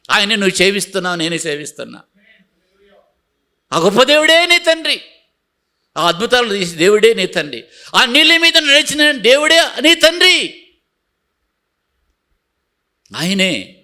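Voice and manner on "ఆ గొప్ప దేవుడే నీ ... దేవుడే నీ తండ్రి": higher, louder, stress on నీ; building preacher's shout; fervent preaching. Acted. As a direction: proclaim it to the congregation. Preaching at full force.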